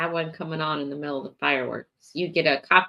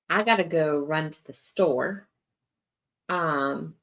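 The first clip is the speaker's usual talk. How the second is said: usual speaking voice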